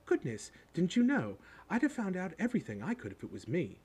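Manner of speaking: high, incredulous schoolgirl voice; officious, pitched well up; bossy girl. A narrator's reading. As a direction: marvel at someone's ignorance, know-it-all style. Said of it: "She had a bossy sort of voice".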